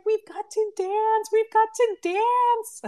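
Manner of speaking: high, slow, gleeful chant; high, drawn-out joyful cry; excited. Retold or imitated. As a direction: squeal it out in celebration. Celebrating after the yes, screamed.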